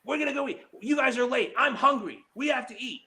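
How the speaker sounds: mimicking her bossy friend, high and whiny; mocking Lori. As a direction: whine it like a demanding friend who's hungry. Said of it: "we had to go on her schedule".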